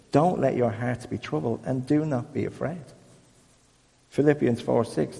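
soft, measured Bible reading; scripture reading. Acted. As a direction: read the verses gently, as from the book. Reads scripture verses aloud.

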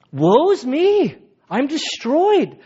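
high, slow, stricken wail; anguished cry. Retold or imitated. Isaiah before the Lord, a high, slow, stricken cry. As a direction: cry out as a man undone.

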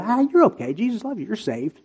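high, soft, glib reassurance; sugar-coated. Acted. Preacher mimicking easy reassurance to sinners, sugar-coated. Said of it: "kind of being sugar-coated of".

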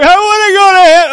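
loud, very high mock wail; bawling child. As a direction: wail like a sobbing small child. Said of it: "four-year-old who suddenly is bawling".

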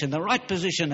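normal talking voice

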